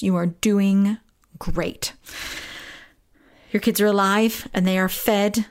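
slow, deliberate reassurance; very slow, each word weighted; emphatic reassurance. Real, not acted. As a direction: spell it out slowly so it sinks in. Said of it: "And hear my voice".

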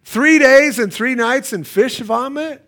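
incredulous. Acted. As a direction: balk at it in disbelief. Mock disbelief at Jonah's delay.

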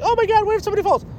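high, fast imitation of a panicking wife; hammed-up high-pitched worry; mock freaking out. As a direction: mimic a wife fretting in a high, rushed voice. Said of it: "The wife is, like, freaking out".

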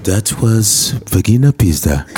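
low, slow radio announcer; back-announce the aria with mock gravity; opera station announcer. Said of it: "they always do that on those, uh, opera stations".

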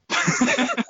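high-pitched burst of laughter; laughing. Real, not acted. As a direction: crack up at the teasing. Laughing at the teasing.

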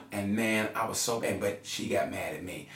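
ordinary talk, nothing marked

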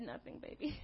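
breathy whisper, sulking. Retold or imitated. Wife to husband, a sulking whisper. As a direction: mutter it under your breath, hurt. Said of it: "I said".

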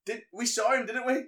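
high, loud put-on voice; accuser rallying a mate. Imitating the killer prompting his mate, high mock urging. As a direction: push for agreement, high and loud. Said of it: "and then he goes".

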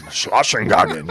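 loud, slow fake German bark; hammed-up angry German; mock angry German. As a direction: spit out pretend German as if furious.

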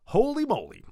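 high, loud burst of amazement; startled exclamation. Acted. Walking in on a full church, startled exclamation.

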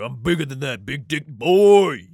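loud mock boast, chest out; hammed-up swaggering king bellowing his size; bragging king. Mocking king boasts to his people, loud swagger.

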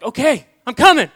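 high, loud eager surrender; giving in eagerly. Retold or imitated. Man in his car answering God, eagerly yielding. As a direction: answer the call, bursting out. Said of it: "And I was like".

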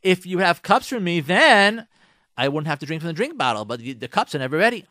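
baiting, defensive husband; husband goading his wife about cups. Pretend. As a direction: egg her on with excuses. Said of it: "he tries to egg you on".

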